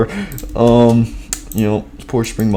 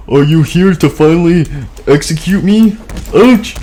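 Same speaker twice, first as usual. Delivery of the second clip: fearful; 6 semitones higher, 3 dB louder than usual. Acted. Fredbear scared in back room.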